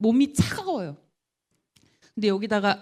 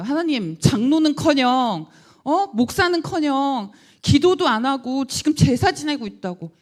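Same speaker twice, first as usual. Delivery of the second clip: angry complaint; 5 semitones higher, 5 dB louder, slower than usual. Retold or imitated. Angry complaint to God retold.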